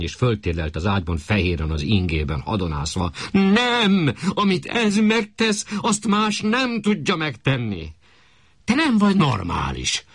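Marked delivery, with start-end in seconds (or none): 3.3-7.9 high, slow and insistent; shouting wife. Acted. Wife insists to her husband that no one can replace the maid. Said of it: "Kiabált az asszony". 8.6-10.0 snapped rebuke. Acted. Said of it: "rivalt rá Vizi".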